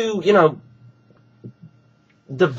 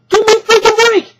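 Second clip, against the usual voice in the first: much higher, louder outburst of disbelief; exasperated shout; 14 semitones higher, 5 dB louder than usual. Real, not acted. Mocking critics who call the match predictable, exasperated shout. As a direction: throw up your hands and yell it. Ranting at critics' double standard.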